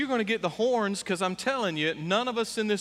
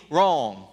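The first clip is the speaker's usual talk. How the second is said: one firm low word; flat verdict; 3 semitones lower, 4 dB louder than usual. Acted. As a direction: cut it off short. Flat rebuttal.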